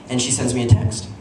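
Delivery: normal talking voice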